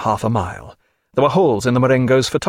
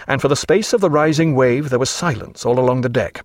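ordinary delivery

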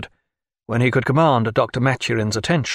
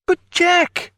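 crying out. A narrator's reading. Naturalist protesting to his friend, high cry. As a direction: burst out in dismay. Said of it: "cried Stephen".

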